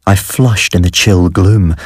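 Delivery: plain reading voice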